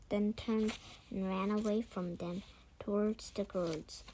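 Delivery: usual speaking voice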